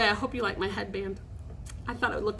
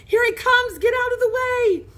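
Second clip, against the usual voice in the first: high, loud panicked shout; yelling; 12 semitones higher, 7 dB louder than usual. A narrator's reading. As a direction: yell a warning across a field.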